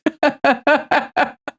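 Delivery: high-pitched giggling fit; laughing. Real, not acted. Streamer cracking up at the Winamp slogan, high laughter. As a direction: crack up completely.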